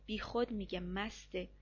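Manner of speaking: usual speaking voice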